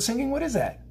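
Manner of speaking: startled high squeak of curiosity; surprised. Real, not acted. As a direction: pop up high in wonder. Surprised at bird sound.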